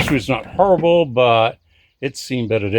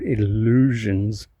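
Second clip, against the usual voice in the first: "illusions" drawn out slowly and lower; stress on illusions; 4 semitones lower, 4 dB quieter, slower than usual. Real, not acted. Owner doubting his hopes of reviving the car, "illusions" slowly stressed. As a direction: stretch out "illusions" in a low, slow voice. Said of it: "I stress that word".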